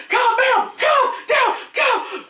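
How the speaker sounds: high shouted calm down, hammed up; mock panicked calming. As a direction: yell it like a movie crowd slapping her. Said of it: "what's the movie Airplane, where they're like".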